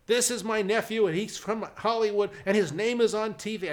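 high, loud boasting uncle; loud proud announcement. Retold or imitated. Uncle bragging about nephew to residents, loud and proud. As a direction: proclaim it to the whole room.

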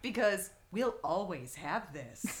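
slow, flat imitated receptionist; dry, unhurried retold punchline; very flat deadpan. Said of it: "looks at me very flatly".

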